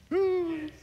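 high drawn-out "woo". Real, not acted. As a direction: let out a high little whoop. Preacher's exclamation after strong point.

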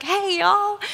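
high, bright Southern American hey y'all; Southern greeting. Shown as a demonstration. Speaker fills an awkward pause in Southern American. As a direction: throw out a Southern hello when stuck. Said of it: "My Southern roots get to come out".

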